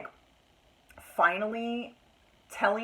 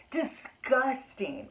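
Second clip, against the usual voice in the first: disgusted drawl; 3 semitones higher, much slower than usual. Real, not acted.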